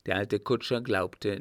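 plain reading voice

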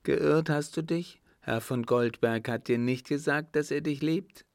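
acted indignant question, rising at the end; exclaiming. A narrator's reading. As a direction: cry out in astonished reproach. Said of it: "schlug die Gräfin die Hände zusammen und rief".

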